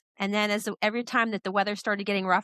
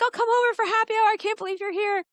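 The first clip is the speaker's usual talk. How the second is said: much higher, louder cheerful invitation voice; delighted mentor; 13 semitones higher, 4 dB louder than usual. Retold or imitated. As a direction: play the delighted friend calling you over for drinks. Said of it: "He's like".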